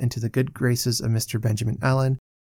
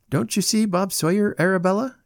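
raised pitch, mildly chiding question; somewhat reproachful; 8 semitones higher than usual. A narrator's reading. Allen prompts his sister to notice Bob Sawyer, mildly reproachful. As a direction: chide her gently, pitch lifted. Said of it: "somewhat reproachfully".

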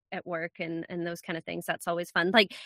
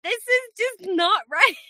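high, drawn-out laughing protest; mock indignation; 10 semitones higher, 3 dB louder, slower than usual. Immigrant protesting to her husband about pronunciation, mock indignation. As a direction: object slowly and high, amused outrage.

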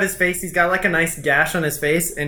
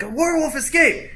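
much higher-pitched exclamation; acted startled alarm, pitched up; alarmed bandit cry; 10 semitones higher than usual. Second bandit seeing the first as werewolf, alarmed cry. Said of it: "bandit number one's like".

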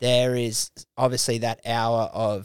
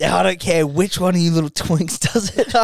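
laughing; 5 semitones higher than usual. Real, not acted. Laughing at own bit.

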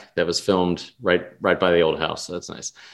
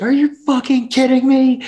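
much higher, loud outraged yell; drunken outburst; 4 dB louder than usual. Retold or imitated. Drunk girl yells at the game's final score, outraged. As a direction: explode at the screen in disbelief. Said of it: "so she has this outburst".

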